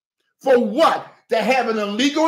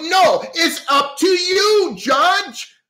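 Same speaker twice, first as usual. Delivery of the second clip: angry shout at the judge; 7 semitones higher, slower than usual. Real, not acted. Pastor shouting at the judge on the livestream, indignant. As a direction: call the judge out, hammering each word.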